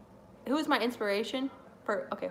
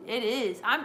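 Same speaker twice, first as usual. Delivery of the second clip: normal talking voice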